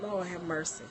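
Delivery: soft weary murmur to herself; quiet groan. Retold or imitated. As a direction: Lord have mercy, sighed out softly. Overwhelmed, muttered to herself.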